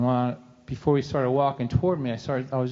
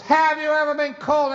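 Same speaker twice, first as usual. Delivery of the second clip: much higher and louder insistent question; pressing repeat; 14 semitones higher, 5 dB louder than usual. Retold or imitated. Dad repeating his question to his son, higher and louder.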